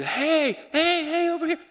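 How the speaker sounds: high, loud mock calling out; attention-getting shout. Preacher mimicking the God some people want, loud high hailing. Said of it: "Some of us want God to do".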